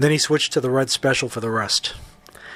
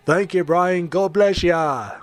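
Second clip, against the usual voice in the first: higher, slow imitated Yorkshire accent; 4 semitones higher, slower than usual. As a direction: drawl out a friendly Yorkshire thanks. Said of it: "in that Yorkshire accent of [name]".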